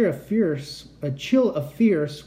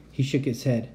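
usual speaking voice

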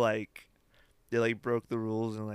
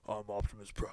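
Optimus Prime voice; 8 dB quieter than usual. Retold or imitated. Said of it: "all of a sudden he's just like".

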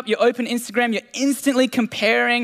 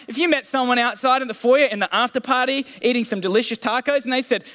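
ordinary talk

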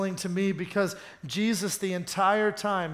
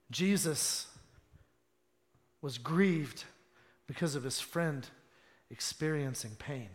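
low, soft, with long pauses; grave, quiet sermon delivery; hushed solemn preaching; 5 semitones lower, 8 dB quieter than usual. Real, not acted. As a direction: let the voice drop and weigh each phrase.

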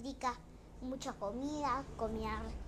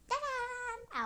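much higher sung-out ta-da; 11 semitones higher, 4 dB louder than usual. Acted. Storyteller revealing the princess transformation, high ta-da. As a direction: pop the reveal up high like a magic trick. Second spell flourish.

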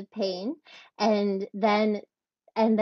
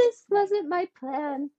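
mock high inner voice; 9 semitones higher than usual. Retelling her own thought in labor.

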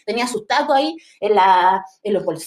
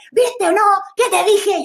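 higher, louder reproach; told-you-so shout; 10 semitones higher, 5 dB louder, slower than usual. Retold or imitated. Daughter scolding her mother after the tear gas, a loud told-you-so. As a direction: rub it in loudly.

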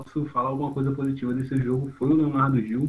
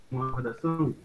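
ordinary delivery

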